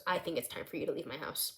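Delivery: low, soft, fast dismissal; quiet acted stern command; stern stepdad. Stepdad ordering Noah out.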